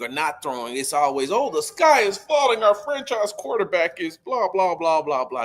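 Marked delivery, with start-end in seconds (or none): 1.3-5.5 mock the online doomsayers; mocking panic. Host mocking fans who panic online, in hammed-up alarm.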